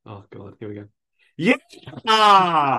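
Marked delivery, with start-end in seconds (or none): 1.4-2.8 loud, high drawn-out yell; acted scream. Host acting the last film quote, high screamed yell. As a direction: let out a long full-force scream.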